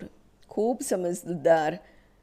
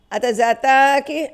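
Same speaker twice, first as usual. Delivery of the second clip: slow, high, loud yell; shout; 6 semitones higher, 9 dB louder, slower than usual. A narrator's reading. Policeman shouts at the stunned mother, loud and slow. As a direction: bellow it out slowly. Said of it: "तो ओरडला".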